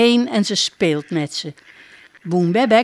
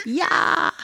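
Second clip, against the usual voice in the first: high quacked yes; a duck's happy quack; 10 semitones higher than usual. Acted. Duck quacking yes to the princess, high. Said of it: "Boeng Bebek kwaakt".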